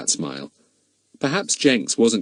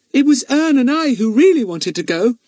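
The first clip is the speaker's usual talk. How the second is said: put-on high, eager little girl; a small girl chiming in; young girl Bets; 6 dB louder than usual. Bets telling the superintendent why they went, eagerly.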